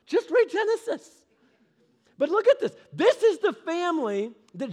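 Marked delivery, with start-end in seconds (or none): 0.1-1.3 emphatic urging. Acted. Urging crowd to read Genesis.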